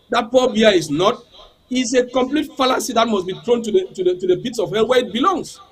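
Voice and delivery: ordinary delivery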